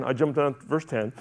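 ordinary talk

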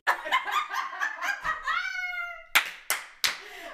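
long, loud, high peals of laughter; uproarious laughter. Real, not acted. Cracking up at McCheesy joke, long loud laughter. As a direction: laugh hard and high, keep it going.

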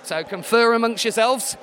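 ordinary delivery

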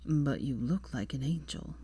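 drowsy awed murmur; Draco, dazed. A narrator's reading. Dazed man at bedside.

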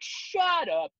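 a high, drawn-out scoff; scoffing dismissal. Retold or imitated. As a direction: brush them off with mock scorn. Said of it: "I'm like".

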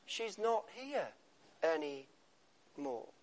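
soft and slow, letting "anymore" fall away; almost a whisper of loss; quiet grief. Shown as a demonstration. Repeats poem title softly.

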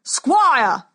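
loud bellowed call; booming warrior king. Acted. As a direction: bellow it across the silent arena. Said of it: "bellowed the king".

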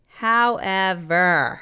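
very slow, stretched-out "However"; milk the word for suspense; ominously elongated transition word; drawn-out turn. Acted. Dramatic turn in letter being read.

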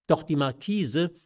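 usual speaking voice

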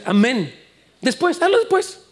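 high, mocking coax to do it later; Satan tempting. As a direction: tease like a sly tempter. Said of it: "Satanás dice".

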